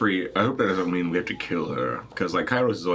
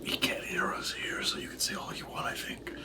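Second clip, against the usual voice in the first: whisper; 11 dB quieter than usual. Acted. Character confiding in a companion, whispering. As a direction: whisper so nobody else can hear. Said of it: "I'm whispering to".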